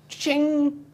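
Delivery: high bright ching like a cash register; a sudden, high "ching!"; imitated till ching. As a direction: ring it out like a till.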